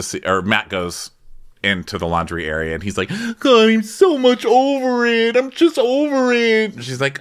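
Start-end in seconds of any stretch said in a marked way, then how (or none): 3.4-6.8 much higher, louder whine; hammed-up chef fed up; mock whiny chef. Podcaster imitating chef moaning in laundry, high and loud. Matt voiced complaining.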